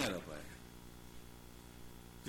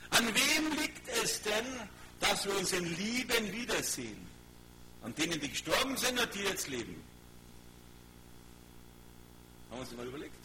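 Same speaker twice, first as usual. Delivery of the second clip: raised, insistent sermon voice; preacher driving the point home, pitched up; forceful preaching; 7 semitones higher, 3 dB louder than usual. Real, not acted. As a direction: press the question on the congregation. Preacher presses the congregation.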